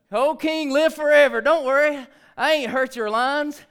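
high, loud call up to the king; Daniel. Acted. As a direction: shout reassurance up from the lions' den. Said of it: "it sounds like this".

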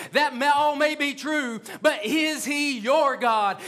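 rising, urgent pulpit preaching. Acted. Pastor urging the congregation about their lions, preaching. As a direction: drive the message home to the pews.

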